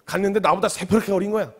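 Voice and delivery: ordinary delivery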